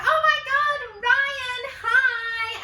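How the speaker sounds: very high, loud, drawn-out mock squeal; excited squealed greeting. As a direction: imitate a gang shrieking hello to a friend. Group greets arriving friend.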